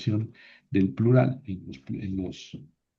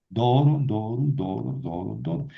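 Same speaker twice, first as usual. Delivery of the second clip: chanted textbook paradigm; declension drill. Shown as a demonstration. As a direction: recite the Greek forms rhythmically. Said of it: "Porque esta palabra es aguda".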